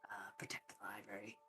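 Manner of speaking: hushed secretive whisper. Acted. Owen lowers voice on danger.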